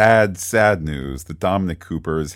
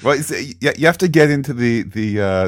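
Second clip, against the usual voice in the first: normal talking voice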